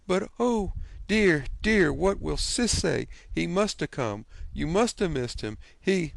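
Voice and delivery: fretting aunt. A narrator's reading. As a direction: work yourself up into a worried fluster.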